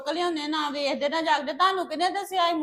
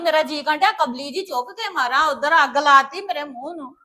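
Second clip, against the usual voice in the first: much louder furious scolding; angry outburst; 7 dB louder than usual. Acted. Daughter shouts at her mother much louder for burning her face. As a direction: yell at her mother in rage.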